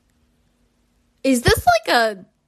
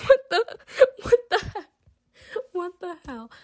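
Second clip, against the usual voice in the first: laughing disbelief; 8 semitones higher than usual. Real, not acted. Shocked reaction, laughing.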